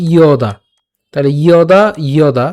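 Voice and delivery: "ইয়দা" opened on a distinct y glide; y sound. Shown as a demonstration. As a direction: pronounce "ইয়দা" as iyo-da, each syllable clear. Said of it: "ইয়র মতো হবে".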